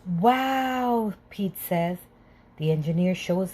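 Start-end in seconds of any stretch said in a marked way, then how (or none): 0.0-1.1 amazed. A narrator's reading. Pete amazed by the engine.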